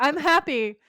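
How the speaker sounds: high, loud imitation of a happy outburst; mock happiness. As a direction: blurt out an exaggerated happy line. Mocking a doomed happy line.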